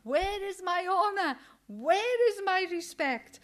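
God's voice demanding respect, shouted; shouting. Acted. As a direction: cry out, slowing on the second question. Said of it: "I was shouting out of my voice".

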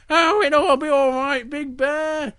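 high, carefree little bear. Acted. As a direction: reassure in a high cub voice. Little Bear reassures Big Bear.

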